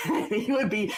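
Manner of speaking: ordinary talk, nothing marked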